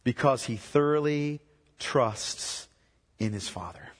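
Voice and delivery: soft and slow, letting each phrase settle; hushed devotional preaching. Acted. Preacher to the congregation, hushed and slow. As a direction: preach gently, like a bedside reflection. Soft meditative opening on trust.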